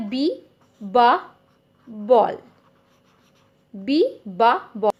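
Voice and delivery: बी बा बॉल, with बा said as a short ba sound; phonic sound drill. Shown as a demonstration. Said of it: "बी से क्या होता है". (फोनिक ध्वनि: बी बा बॉल)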